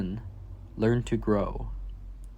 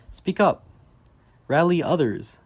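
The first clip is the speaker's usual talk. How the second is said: louder and higher, a rousing call; emphatic call; 5 semitones higher, 5 dB louder than usual. Acted. Reading to children, rallying them to speak up with a loud call. Refrain urging kids to speak.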